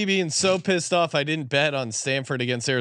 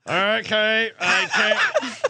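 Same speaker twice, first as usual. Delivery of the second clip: talking through a clenched jaw; mock wired-jaw voice, higher; jaw wired shut; 7 semitones higher, much slower than usual. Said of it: "someone who had their jaw wired shut".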